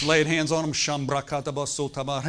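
loud burst of tongues, words tumbling out; preacher imitating a prayer in tongues; mock tongues. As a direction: rattle off tongues like a revival. Preacher mimics speaking in tongues.

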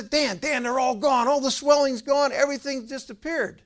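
high, loud, hysterical excitement; imitated frantic, overjoyed caller; ecstatic shouting. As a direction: shout the news as if beside yourself. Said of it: "I mean, he was ecstatic".

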